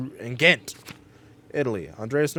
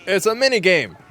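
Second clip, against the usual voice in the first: surprised; 8 semitones higher, 9 dB louder than usual. Real, not acted. Surprised by mini game.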